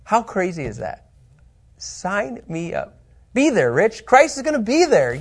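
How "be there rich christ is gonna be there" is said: excited. Real, not acted. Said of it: "I thought you would have said it with more emphasis".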